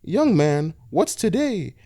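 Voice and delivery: put-on high old woman asking; high forgetful old-lady question. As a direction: ask it high like a forgetful granny. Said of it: "came back".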